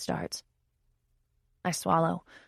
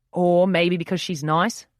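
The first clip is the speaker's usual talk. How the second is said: playful challenge; 8 dB louder, slower than usual. A narrator's reading. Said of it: "a playful challenge in his voice".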